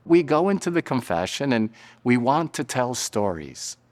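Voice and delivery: usual speaking voice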